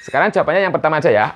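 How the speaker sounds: usual speaking voice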